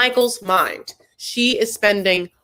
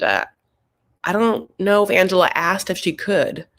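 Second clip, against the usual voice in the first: ordinary delivery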